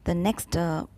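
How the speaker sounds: ordinary talk, nothing marked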